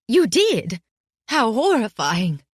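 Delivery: mild theatrical shock at bad news. A narrator's reading. Headmistress hears grim news.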